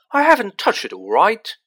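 furious roar. Acted. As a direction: bellow it back at him. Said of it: "Ron roared back".

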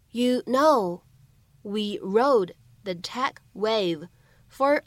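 slow, careful read with the links shown phrase by phrase; slow practice read. Shown as a demonstration. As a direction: read each phrase slowly for the learner. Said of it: "我们需要注意哪些发音技巧呢". (慢速逐句示范朗读)

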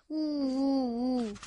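drawn-out mock sobs, "wu wu"; old man's stagy wailing; acted wailing. Grandpa breaks down over his failure, acted wailing. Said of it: "故事爷爷嚎啕大哭起来".

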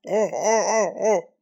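high, loud panicked wails; the pig pinned down on the bed, wailing loudly; crying out. A narrator's reading. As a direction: howl out in a struggle. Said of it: "猪八戒叫了几声".

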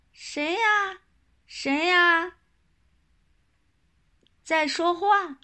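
put-on little girl voice. Little girl asks who speaks.

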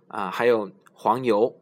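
ordinary talk

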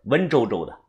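plain reading voice

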